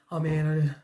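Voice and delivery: low, mumbled 'oh no, I messed up'; a touch of defeated self-pity; imitating the querent's gloomy self-talk; mock dejected. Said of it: "that's what I'm hearing right now".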